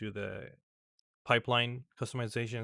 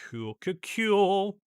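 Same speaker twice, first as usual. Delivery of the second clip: excited; 10 semitones higher, 10 dB louder, slower than usual. Real, not acted. Installer working as hoped.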